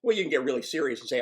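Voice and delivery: normal talking voice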